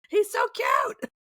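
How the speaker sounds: very high, drawn-out gushing shout; excited squeal. Retold or imitated. Showing the baby off at the office, squealing with delight. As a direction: re-enact squealing over a baby.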